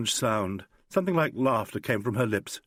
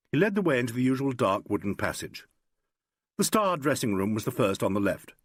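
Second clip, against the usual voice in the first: ordinary delivery, nothing marked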